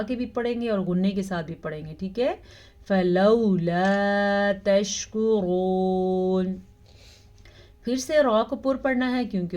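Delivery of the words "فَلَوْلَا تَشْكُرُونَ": slow melodic tajweed chant; measured Quranic recitation. Shown as a demonstration. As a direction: recite slowly, drawing out each vowel. Recitation demo.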